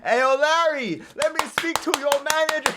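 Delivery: cracking up at the tackle, voice way up; real giddy outburst at the cartoon; excited laughing reaction. Real, not acted. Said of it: "This is genuinely how I reacted".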